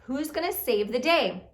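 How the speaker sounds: ordinary delivery, nothing marked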